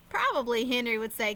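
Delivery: plain reading voice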